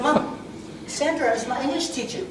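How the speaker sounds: ordinary talk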